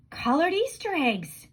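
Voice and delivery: declaring. A narrator's reading. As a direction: announce it like a big idea. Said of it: "declared Clayton".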